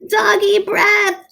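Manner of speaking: high squeaky terrified cry; panicked scream. A narrator's reading. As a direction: shriek in disgust and fear. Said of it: "screamed the soldiers".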